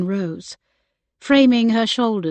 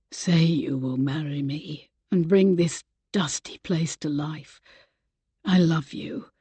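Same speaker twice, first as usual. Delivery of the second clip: husky voice; 4 semitones lower, 4 dB quieter than usual. Acted. Man proposing to Rebecca right after a kiss, husky near whisper. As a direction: lower and softer, a near whisper. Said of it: "he said in a husky voice".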